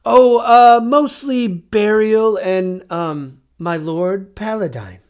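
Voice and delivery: put-on high, loud, slow old voice; reverent mummy. Mummy explains his work.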